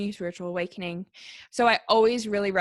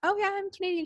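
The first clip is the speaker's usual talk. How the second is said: higher, mock relieved reply; lightly acted relieved answer, pitched up; 11 semitones higher, faster than usual.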